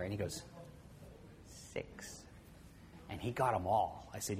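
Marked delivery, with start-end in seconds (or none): 1.7-2.2 breathy whispered answer; hushed little boy; whispered six. Retold or imitated. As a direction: whisper the number like a secret. Ivan answers quietly.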